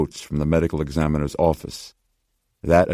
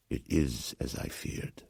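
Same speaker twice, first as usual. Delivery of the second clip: whispered and slowed, much softer, crushed; bitter whisper; 3 semitones lower, 13 dB quieter than usual. Acted. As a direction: let the old man's hope drain out in a whisper. Swallowed with bitter disappointment.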